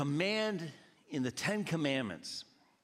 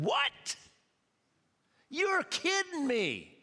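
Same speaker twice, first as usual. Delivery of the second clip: higher, slow, disbelieving voice; Jesus incredulous; 13 semitones higher, 4 dB louder, slower than usual. Acted. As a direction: play Jesus amazed at his disciples. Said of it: "And Jesus said".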